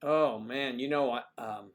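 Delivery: ordinary talk, nothing marked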